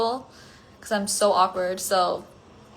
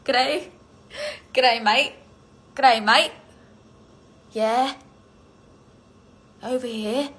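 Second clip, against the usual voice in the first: higher-pitched mock Australian; Australian accent; 4 semitones higher, 4 dB louder than usual. Shown as a demonstration. Streamer showing viewers what Australian sounds like, mock Australian accent. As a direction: try out a broad Aussie accent. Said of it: "how is an Australian accent like?".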